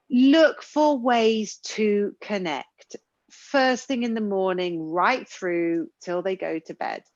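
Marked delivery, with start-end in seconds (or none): none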